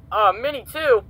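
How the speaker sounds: high, loud exclamation; surprised shout. Real, not acted. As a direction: blurt it out in shock. Another character dies.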